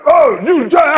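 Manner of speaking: mock angry woman yelling back; her loud retort. Date yelling back at him, loud mock retort. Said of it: "She said".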